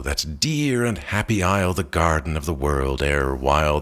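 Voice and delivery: plain reading voice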